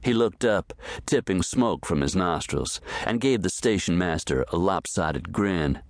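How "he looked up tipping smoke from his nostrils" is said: usual speaking voice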